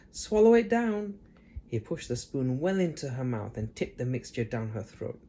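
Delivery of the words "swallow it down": George. A narrator's reading. George urging Grandma.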